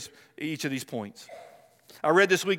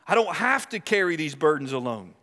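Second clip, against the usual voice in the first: ordinary talk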